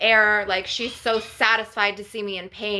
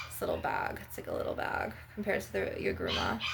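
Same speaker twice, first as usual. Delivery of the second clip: much lower, breathy whisper; 12 semitones lower, 11 dB quieter than usual. Real, not acted. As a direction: drop into a whisper. Showing herb bag to camera.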